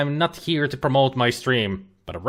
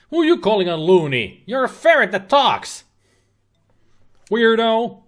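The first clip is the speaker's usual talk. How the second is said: mock indignant; 10 semitones higher, 5 dB louder than usual. Mock-indignant retort to the ferret.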